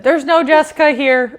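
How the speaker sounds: high, loud retort breaking into laughter; retold shout with a laugh; mock calling back. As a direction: call it back to the crowd, amused. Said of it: "at one point I said".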